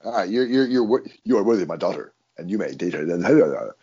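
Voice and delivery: a hint of grave fatherly approval; stern father. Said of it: "and Wayne said".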